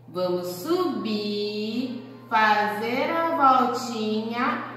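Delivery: stretched, very slow tracing words; slow demonstration. Shown as a demonstration. Teacher shows the stroke to kids, very slow. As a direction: draw each word out as you trace. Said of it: "o ponto de partida".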